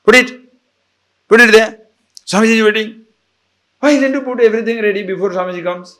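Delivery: loud, high, pushy orders; brusque and impatient; rough, rude speech. Retold or imitated. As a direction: imitate a husband scolding his wife, building into a fast rebuke. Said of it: "but rough speech".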